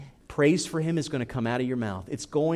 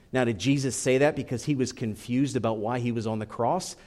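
usual speaking voice

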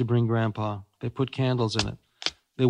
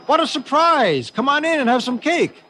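delighted Grandpa; 13 semitones higher, 5 dB louder than usual. Acted. Grandpa inviting the Queen inside, high and loud. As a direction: welcome the royals with glee. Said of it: "Grandpa shouted back".

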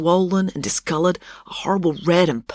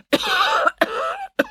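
high, strained coughing fit; hacking fit of coughs. Acted. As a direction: break down coughing. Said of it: "broke down in a coughing fit".

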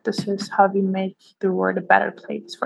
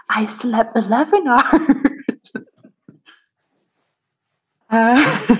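laughing; 2 semitones higher, slower than usual. Retold or imitated. Mock-proud self-talk, laughing.

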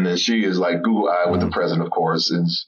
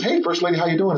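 raised pitch, upbeat hello; bright greeting; 8 semitones higher, faster than usual. Retold or imitated. Friend greets the First Lady, upbeat greeting. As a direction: greet her in a lifted, chipper voice.

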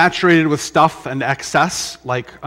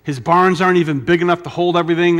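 ordinary talk, nothing marked

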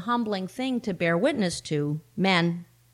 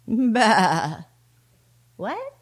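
doubtful sheep. Acted. Sheep reacts to God's order.

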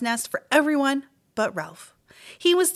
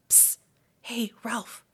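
soft hissed whisper; whispered call; 3 semitones higher, 8 dB quieter, slower than usual. A narrator's reading. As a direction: call him quietly through the hole. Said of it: "whispered Keith".

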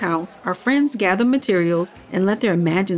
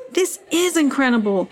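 higher, thrilled exclamation; excited; 6 semitones higher than usual. A narrator's reading. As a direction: burst out with delight. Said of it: "Henry exclaimed with excitement".

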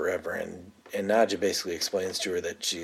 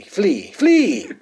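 hammed-up vampire cry, rising high and loud; mock dramatic flee; 11 semitones higher, 9 dB louder than usual. Nandor urging the victim to run, hammed-up cry. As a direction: shoo him off in a melodramatic vampire voice.

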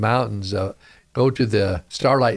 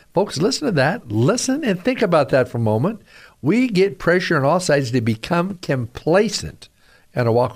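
higher, pressing preacher voice; preaching; 4 semitones higher than usual. Acted. Preacher urging radio listeners, fervent preaching. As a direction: drive the point home to the flock.

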